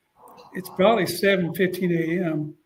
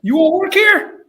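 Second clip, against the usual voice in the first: high, loud blunt question; much higher, louder coach voice; Coach Spurrier impression; 11 semitones higher, 8 dB louder than usual. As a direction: ask it straight, pitched way up. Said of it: "That's how he talks".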